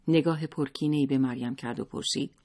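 usual speaking voice

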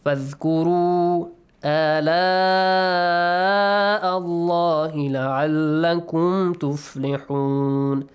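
sustained tajweed chant with long vowels; Quran recitation. Shown as a demonstration. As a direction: recite the verse, holding each mad. Teacher recites the verse.

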